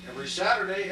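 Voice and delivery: ordinary talk, nothing marked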